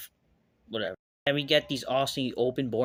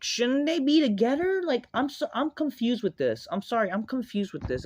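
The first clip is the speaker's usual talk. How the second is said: high-pitched incredulous confusion; incredulous, easing down from a high peak; baffled exasperation; 10 semitones higher, 3 dB louder than usual. Real, not acted. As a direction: protest in bewilderment, pitched way up. Baffled by the storyline.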